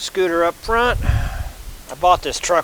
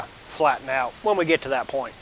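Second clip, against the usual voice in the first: ordinary talk, nothing marked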